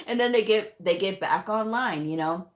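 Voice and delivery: ordinary delivery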